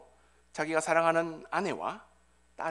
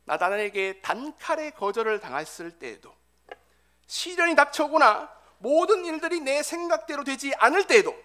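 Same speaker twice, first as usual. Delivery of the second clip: loud, raised-pitch preaching; forceful preaching; 8 semitones higher, 9 dB louder than usual. Real, not acted. Preacher telling the congregation of rejection and frustration, impassioned preaching. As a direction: drive each phrase home, building to a high peak.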